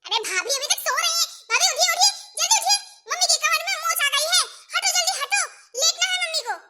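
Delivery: high, hurried shouts to wake someone; urgent yelling. Acted. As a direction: yell impatiently at a sleeper. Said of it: "इतना तेज तेज क्यों चीख रही है". (ज़ोर-ज़ोर से चीखकर जगाना)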